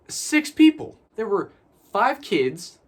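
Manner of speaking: high, loud, exasperated emphasis; incredulous emphasis. Real, not acted. Reviewer scoffing at how few people fill a giant hospital, incredulous. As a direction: punch it out in disbelief, with pauses.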